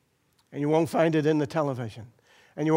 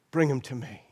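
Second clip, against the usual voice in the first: gentle, quieter invitation; soft tender call; 5 semitones lower, 5 dB quieter than usual. Acted. Savior inviting the wounded listener, soft and tender. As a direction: say it as a gentle welcome. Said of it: "says the same thing to you and I".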